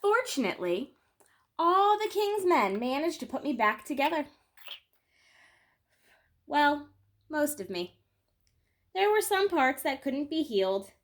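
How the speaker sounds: gentle picture-book read-aloud, lingering on key moments; storytelling. Acted. Reading a children's book to her viewers, gentle storytelling. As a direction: tell it like a bedtime story for kids.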